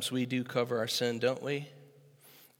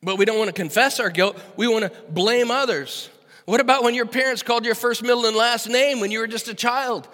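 loud, higher pulpit preaching; full-force preaching; 8 semitones higher, 9 dB louder than usual. Acted. Pastor challenging listeners about blame, loud preaching. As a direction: drive it home to the congregation.

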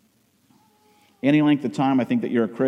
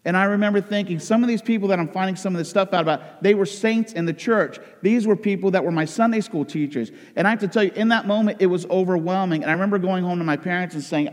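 normal talking voice

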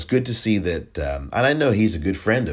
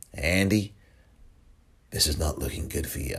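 poor impersonation of a senior politician, softer; Chuck Schumer; 2 semitones lower, 5 dB quieter than usual. Acted. Senator warning the governor privately, quiet mock-politician voice. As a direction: quietly break bad news like a party elder. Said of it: "trying to do my Cuomo, my, uh, Schumer voice".

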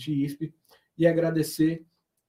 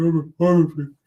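loud, bossy imitation of the old chief; imitating the boss; 4 semitones higher, 7 dB louder than usual. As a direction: bark orders like an angry boss. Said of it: "Ô, Paulão, falava assim".

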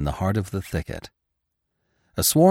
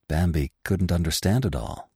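usual speaking voice

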